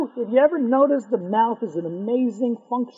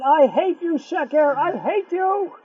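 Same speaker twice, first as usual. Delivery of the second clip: put-on defiant young man; 7 semitones higher, slower than usual. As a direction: spit out the vow to hate falsehood. Young man's slogan against lies.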